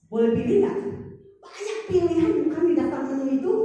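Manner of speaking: lightly voiced child, high and loud; a hint of a child begging to choose; eager child asking. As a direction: plead like a curious little kid. Child asking mother at restaurant.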